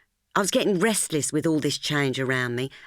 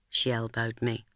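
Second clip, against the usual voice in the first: plain reading voice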